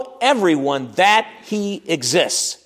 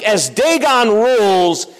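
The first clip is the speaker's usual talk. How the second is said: boastful giant; 5 semitones higher, 3 dB louder than usual. Retold or imitated. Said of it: "Maybe he said something like".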